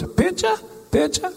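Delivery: high, rising eager tourist voice; high-pitched imitation of an eager Japanese tourist. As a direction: ask it way up high, pleading. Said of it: "She goes".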